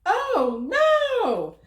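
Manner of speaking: high, loud playful "oh no"; mock dismay. Storyteller to listening kids, hammed-up high alarm. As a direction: gasp it for the kids in fun.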